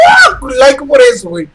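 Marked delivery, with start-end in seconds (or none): 0.1-0.4 excited yell. Real, not acted. Victory yell.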